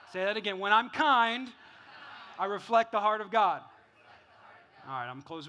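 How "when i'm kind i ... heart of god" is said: louder call-out for a crowd; leading a repetition. Real, not acted. Pastor asking church to say it again, loud and deliberate. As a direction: project each half, leaving room to echo.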